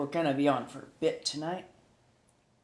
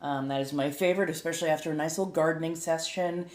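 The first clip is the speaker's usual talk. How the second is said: usual speaking voice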